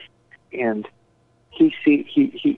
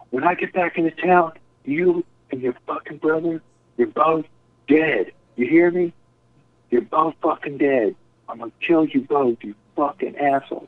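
higher-pitched mock yell, no real volume; retold angry yell; 6 semitones higher than usual. Friend threatening someone over the phone, mock yelling. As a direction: act out an irate phone threat. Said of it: "and he's yelling".

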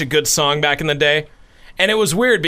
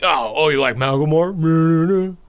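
mocking gym bro. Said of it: "people are like".

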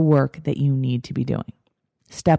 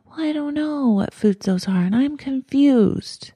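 higher, whining that it's all too confusing; mock-whiny confused complaint, pitched up; reluctant whiny voice; 7 semitones higher than usual. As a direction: play the reluctant student who doesn't want to do it. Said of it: "That's my voice, by the way, when I don't really want to do something".

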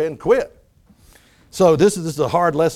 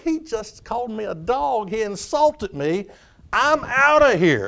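higher-pitched, drawn-out offended retort; mock indignation; 6 semitones higher than usual. Woman retorting to being called a dog, mock indignant. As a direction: act out an offended walk-out. Woman imagined reacting to insult.

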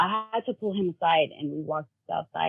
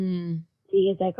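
usual speaking voice

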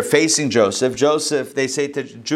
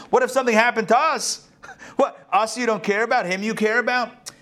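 higher, laughing mock outrage; mock indignant; 10 semitones higher than usual. Brothers mocking their father's favoritism, laughing and indignant. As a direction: play the slighted brothers, half laughing.

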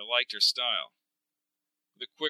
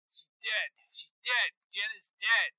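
high, thin cry of shock; panicked radio report, pitched way up; distraught; 9 semitones higher, 5 dB quieter than usual. Acted. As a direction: break terrible news in a high, distressed voice. Mark reports a death by radio.

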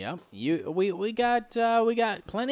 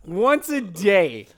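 slow and loud, each word hit; slow emphatic repeat; 6 dB louder, much slower than usual. Acted. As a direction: drag out Once a day, loud and deliberate.

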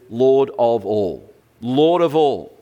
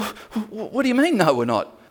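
stunned disbelief; 9 semitones higher, faster than usual. Acted. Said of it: "And he's going".

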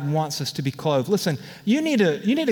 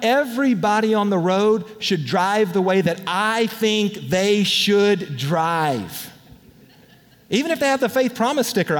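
usual speaking voice

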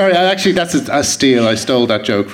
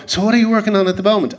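brisk, higher, faster fan's question; patronising question; 8 semitones higher, faster than usual. Retold or imitated. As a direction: ask it like you doubt their career. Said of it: "the tone of the question that means".

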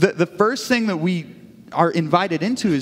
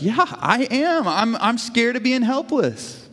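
high, slow, pleading confession; retold scared admission; 6 semitones higher, slower than usual. Wife admits her fear to God, mildly distressed. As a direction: imitate an emotional admission, drawn out and high.

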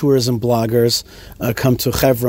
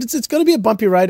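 quoting himself; 7 semitones higher, faster than usual. Retold or imitated. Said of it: "and I said".

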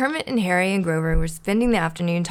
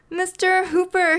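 high, drawn-out joyful exclamation; excited high-pitched outburst; exclaiming; 13 semitones higher, 4 dB louder than usual. A narrator's reading. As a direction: cry out in thrilled amazement. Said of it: "he exclaimed".

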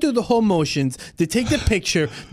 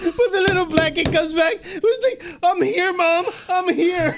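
laughing; 12 semitones higher than usual. Real, not acted. Laughing while retelling.

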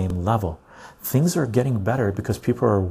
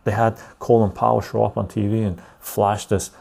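ordinary delivery

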